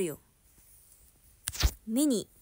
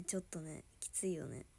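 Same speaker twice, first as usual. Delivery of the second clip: whisper; 7 semitones lower, 11 dB quieter than usual. Real, not acted. Whispered about cockroaches.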